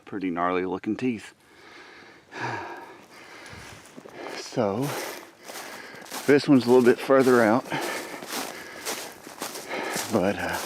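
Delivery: out of breath; panting between words; gasping for air. Real, not acted. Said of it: "I'm, I'm out of breath".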